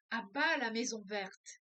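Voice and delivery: loud rioters' chant; crowd shouting. Acted. Teacher reading the rioters' cry in the novel, loud shout. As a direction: bellow it like an angry mob at the gate. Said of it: "hurlaient les canjicas".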